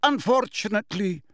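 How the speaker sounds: high, strained politeness; taut, falsely pleasant; forced smile. A narrator's reading. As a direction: force courtesy through a stiff smile. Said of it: "attempt at a reciprocal smile".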